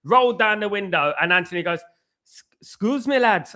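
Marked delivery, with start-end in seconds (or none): 2.2-3.5 higher-pitched imitation Geordie; Geordie accent. Imitating a man at the car window addressing the lads, in a Geordie accent. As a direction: put on a lilting, amateur Geordie accent. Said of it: "I don't know if this is a good Geordie accent".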